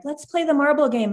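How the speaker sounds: high, childlike mock pleading; imitating eager daughter. Daughter asking mom to play a game, eagerly imitated. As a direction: pipe up like a little kid wanting play. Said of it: "my daughter was like".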